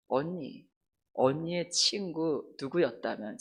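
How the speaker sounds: low, soft, slow retold reproach; quiet reproach. Crying friend confronts the older girl, quiet reproach.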